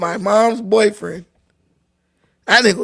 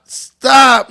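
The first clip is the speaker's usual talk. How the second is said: a louder, firmer second stop; 3 semitones higher, 7 dB louder than usual. Real, not acted. Host cuts off the joke with a louder stop. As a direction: cut him off, louder this time.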